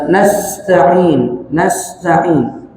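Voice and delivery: nasta'in said slowly with a sharp hissed s. Shown as a demonstration. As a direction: hiss the sin in nasta'in. (nasta'in dengan sin berdesis)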